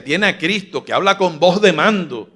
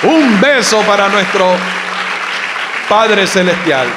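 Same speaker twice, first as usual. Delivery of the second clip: loud, fervent preacher's cry; full-force preaching; 2 semitones higher, 5 dB louder than usual. Acted. Pastor crying out to the congregation in fervor.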